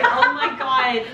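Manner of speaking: startled laugh; laughing oh my God. Real, not acted. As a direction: laugh it out after a fright.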